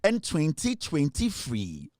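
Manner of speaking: slow drawn-out "twenty twenty free"; mocking Atobra's English. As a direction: mimic the mangled "free". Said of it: "wa atobralize brofo no".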